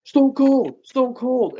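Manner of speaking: high-pitched imitated fan call. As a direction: call it out high, trying to catch a star's attention. Friend calls out to wrestler.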